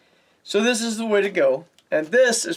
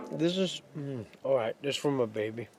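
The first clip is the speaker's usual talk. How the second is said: normal talking voice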